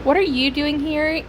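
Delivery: ordinary talk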